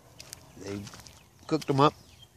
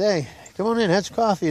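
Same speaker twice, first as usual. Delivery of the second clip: higher-pitched hearty welcome; welcoming uncle; 8 semitones higher than usual. Acted. Uncle inviting nephew in, lightly acted higher voice. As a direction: lightly voice an uncle calling someone in.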